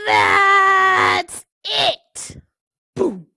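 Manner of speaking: furious loud pigeon shout; pigeon explodes. Acted. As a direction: blow up at the duckling. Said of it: "His words were so loud".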